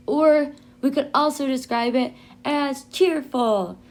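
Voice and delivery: ordinary talk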